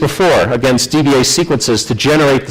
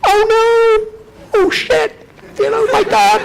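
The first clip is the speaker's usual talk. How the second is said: much higher, squealed panic; panicked inner voice; slower than usual. Retold or imitated. Inner voice seeing the error on both nodes, high panic. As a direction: shriek in sudden dread. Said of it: "that little voice in the back of my head is going".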